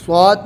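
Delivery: "صاد" said thick and full-mouthed; thick, heavy letter. Shown as a demonstration. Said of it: "موٹا بولنا ہے".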